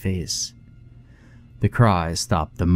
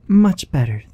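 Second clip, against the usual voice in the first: put-on little girl's thanks; 7 semitones higher, 3 dB louder than usual. A narrator's reading. Christabel answers Della.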